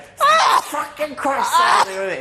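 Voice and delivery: very high, loud, slow yell through laughter; mock furious yell. Retelling housemate's rage at the cake, laughing mock yell. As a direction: scream it like she's lost it at the cake. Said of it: "She went".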